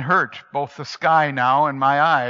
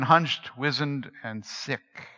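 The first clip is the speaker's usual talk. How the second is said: plain reading voice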